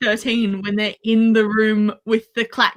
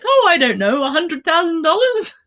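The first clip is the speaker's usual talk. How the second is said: breezy, higher-pitched retold offer with a rising end; mock-casual taunt; 6 semitones higher than usual. Retelling the hero goading Guilt into a wager, mock-casual. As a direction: toss it out airily, lifting at the end.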